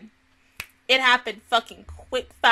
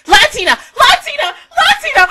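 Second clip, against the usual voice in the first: each shout higher and louder, yelling the name; triumphant shouts; 12 dB louder than usual. Real, not acted. Finally remembering the girl's name, shouting it. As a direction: blurt it out in sudden triumph.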